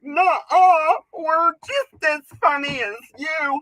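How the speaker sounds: high, loud mocking woman voice. Comic imitating women objecting, high and whiny. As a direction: whine it like a scoffing woman. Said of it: "women are gonna be like".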